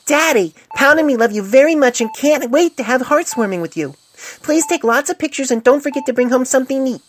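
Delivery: high, loud little foal voice; child's letter voice. A narrator's reading. Doctor reading the foals' letter to their father, childlike. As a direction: read it like an eager little child. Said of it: "to read from it".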